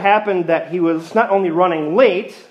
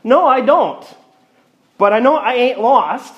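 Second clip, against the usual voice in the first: put-on cheeky little boy; 8 semitones higher, 4 dB louder than usual. Little boy's cheeky punchline.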